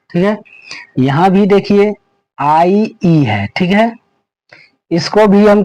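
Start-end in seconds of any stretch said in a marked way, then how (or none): none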